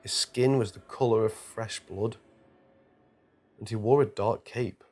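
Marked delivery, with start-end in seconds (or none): none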